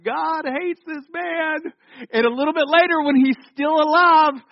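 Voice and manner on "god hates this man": high, drawn-out crowd pronouncement; villagers' verdict. Acted. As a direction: slowly proclaim it, pitched up, as astonished villagers. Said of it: "the villagers were like".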